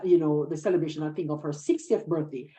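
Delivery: ordinary delivery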